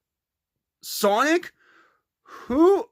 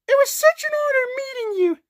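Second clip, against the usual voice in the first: much higher, fast, teary-sounding gush; gushing mother rabbit; 11 semitones higher, faster than usual. Pretend. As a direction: gush up high like a moved mom. Vanilla greets SpongeBob gushingly.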